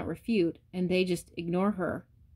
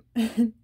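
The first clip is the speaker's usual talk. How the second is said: short laugh; 4 semitones higher, 5 dB louder than usual. Real, not acted. Laughs mid-list.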